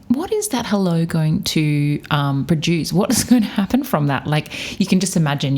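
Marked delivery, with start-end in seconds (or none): none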